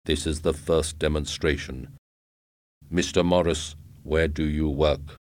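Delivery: cold, even robot pitch; robot salesman. A narrator's reading.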